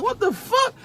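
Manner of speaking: high, incredulous outburst; can't believe what he's hearing; disbelief. Real, not acted. Storyteller reacting to a lowball offer, high and incredulous.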